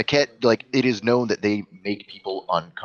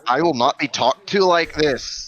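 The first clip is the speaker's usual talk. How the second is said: loud, higher-pitched indignation; offended; 5 semitones higher, 4 dB louder than usual. Acted. Game master's character before storming off, indignant. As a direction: refuse the insult with wounded pride.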